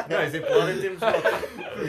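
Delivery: laughing through the words. Real, not acted. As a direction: talk while cracking up. Laughing while correcting friend.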